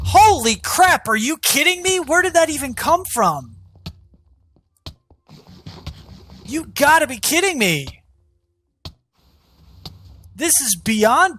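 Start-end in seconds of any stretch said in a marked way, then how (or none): 0.0-7.9 much higher and louder, stretched holy crap; real disbelief, pitch jumps way up; shocked outburst. Real, not acted. Gamer ambushed by the Wither, shocked high outburst.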